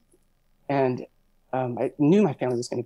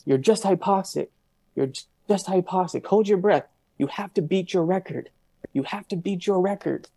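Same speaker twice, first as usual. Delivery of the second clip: higher-pitched, urgent retold shout; retold shouting inner voice; 6 semitones higher than usual. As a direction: imitate a shouting voice in your head, pitched up without full volume. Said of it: "was shouting".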